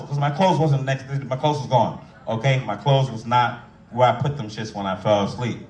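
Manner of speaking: ordinary talk, nothing marked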